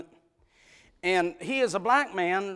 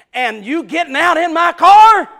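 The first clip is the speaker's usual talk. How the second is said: loud, high retold yell at his wife; husband scolding his wife in alarm; scolding shout; 11 semitones higher, 18 dB louder than usual. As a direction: bark the question like a frightened husband. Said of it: "I scolded her".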